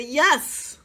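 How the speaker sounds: high, exclaimed yes; exclaimed approval. A narrator's reading. Subjects liking what someone was offering, exclaiming. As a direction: cry out approval like the king. Subjects copying the king's yell.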